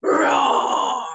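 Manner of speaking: loud, breathy playful roar; lion roar. Shown as a demonstration. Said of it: "One more time".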